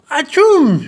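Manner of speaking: high, loud atchoum; loud sneeze. A narrator's reading. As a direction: sneeze with a roar. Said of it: "hurle-t-il".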